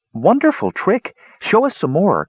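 high, excited calling out; delighted call. A narrator's reading. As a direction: cheer the trick on, pitched high. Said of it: "called out the women".

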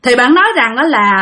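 ordinary delivery

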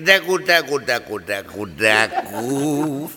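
low imitated trickling sound; peeing noise. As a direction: mimic the stream. (imitación grave del chorro)

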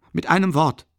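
almost yell it, losing patience; near shout. A narrator's reading. Raskolnikow almost shouts at the investigator. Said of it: "schrie er fast".

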